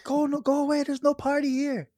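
high mock lady voice shooing him off; lady at the door telling a drunk guest to leave, high mock voice; imitating a grumpy lady. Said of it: "She's like".

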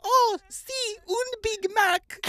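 very high, slow mock Spanish-accented woman. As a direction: pitch it way up as the employee. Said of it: "Finally, the employee understands and nods her head".

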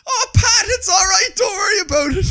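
put-on high, loud breezy old man voice; the man in the wheelchair forgives the driver, high loud and breezy; cheerful old man. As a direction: wave it off like a chirpy old fella. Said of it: "he goes".

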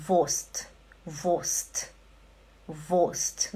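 Wurst as voscht, no R; German pronunciation. Shown as a demonstration. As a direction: Wurst said short with a silent R.

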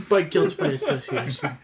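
usual speaking voice

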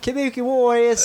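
high mock accent on "Kabuki Warriors"; put-on accent. Shown as a demonstration. Asked to say the name in the accent. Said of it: "say Kabuki Warriors in the accent".

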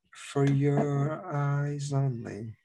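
slow, soft dramatic delivery; Bond theme line. Retold or imitated. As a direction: draw it out like a title song. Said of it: "and then".

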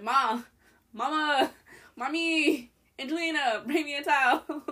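high, insistent calling, replayed for laughs; calling out for mom. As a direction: imitate yourself hollering for mom from the bathroom. Calling mom for a towel, retold.

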